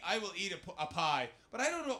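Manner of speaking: usual speaking voice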